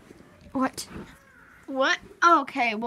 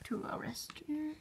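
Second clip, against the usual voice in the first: whispering; 2 semitones higher, 13 dB quieter than usual. Pretend. Whispered question about next place.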